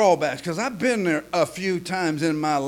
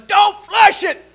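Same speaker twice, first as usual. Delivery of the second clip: high, loud, slowing shouts from the pool; panicked yelling; 11 semitones higher, 10 dB louder, slower than usual. Retold or imitated. Man in the pool yelling to nobody, hammed-up panic. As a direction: yell it in mock panic. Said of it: "he started yelling".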